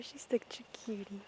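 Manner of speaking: whispered aside to the camera; soft whisper. Real, not acted. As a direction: whisper it, melting over the pony. Cooing over a cute pony.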